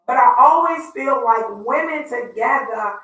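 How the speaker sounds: ordinary talk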